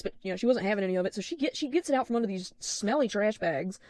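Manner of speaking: ordinary delivery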